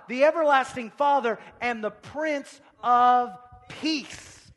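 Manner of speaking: loud, high, building preacher's cry; full-force preaching. Real, not acted. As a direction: preach it out, punching the key words.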